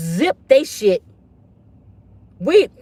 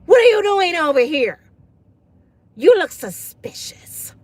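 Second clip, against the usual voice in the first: louder, accusing, slowing on suspicious; mock a Karen calling someone out; 4 semitones higher than usual.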